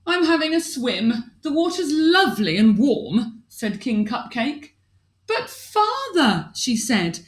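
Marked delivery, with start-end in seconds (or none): 0.1-3.4 cheerful king. Acted. King voiced in story. 5.3-6.5 pleading princess. Acted.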